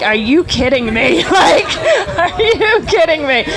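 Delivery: retold riders' incredulous outburst, high and laughing. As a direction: imitate baffled riders, laughing through it. Retelling riders' amazed reaction.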